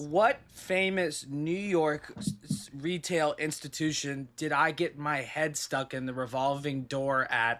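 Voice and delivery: drawn out slowly, like a quizmaster; slow quiz question. Real, not acted. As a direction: spell it out slowly for them. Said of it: "Trivia of myself".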